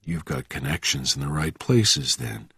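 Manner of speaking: low, soft, controlled reply; cool and quiet. A narrator's reading. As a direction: answer calmly beneath the other's panic. Bourne stays cool against the screaming.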